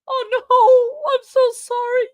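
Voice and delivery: high, loud alarmed oh no; freaking out. Real, not acted. Streamer who timed out Hannah, freaking out. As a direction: cry out in real alarm. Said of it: "I'm freaking out".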